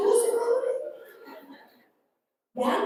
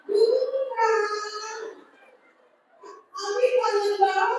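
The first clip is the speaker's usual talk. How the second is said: much higher, sweet gushing call; gushing husband; 9 semitones higher, slower than usual. Retold or imitated. Husband calling his wife, gushing with longing. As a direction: coo at his wife like he missed her terribly. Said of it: "Ibu mau suaminya kayak gitu?".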